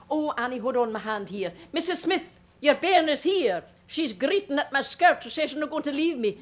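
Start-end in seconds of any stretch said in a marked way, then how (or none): 1.7-6.4 higher, louder call to the mother over the crowd. Acted. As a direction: shout for Missus Smith to fetch her bairn. Calling the child's mother.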